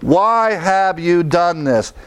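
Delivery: "Why" stretched high and slow; slow emphatic question. Shown as a demonstration. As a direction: drag out "Why have you done this?". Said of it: "think about that".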